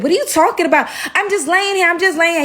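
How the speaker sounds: put-on high-pitched, defensive girl's voice; protesting imitation. Girl denying she was on the phone, high defensive protest. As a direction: plead innocence up high, imitating her.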